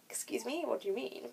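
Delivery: soft, quiet mimicked query; puzzled aside. Examiner queries her vague accent choice, quietly puzzled. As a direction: imitate a puzzled question under your voice.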